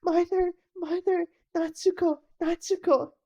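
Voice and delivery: pleading cry for mother; desperate calling. Acted. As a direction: call out as if no one can hear.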